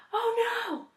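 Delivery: high, sudden gasp of alarm; king alarmed for his friends; alarmed cry. Acted. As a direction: react to the danger in shock. King sees friends in danger.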